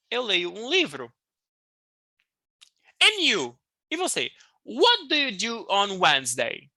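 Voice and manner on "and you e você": usual speaking voice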